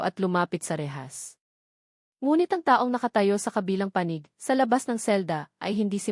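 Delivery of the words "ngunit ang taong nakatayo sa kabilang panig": ordinary delivery, nothing marked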